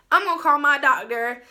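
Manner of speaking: retold urgent decision. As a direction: blurt it out, done waiting.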